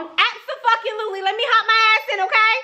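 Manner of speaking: thrilled to accept the ride; excited yes. Real, not acted.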